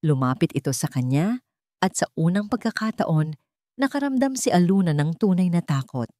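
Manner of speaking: ordinary delivery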